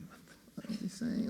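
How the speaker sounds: whispered mock aside; hushed puzzlement. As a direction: whisper it as if baffled on the phone. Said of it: "you'll be going".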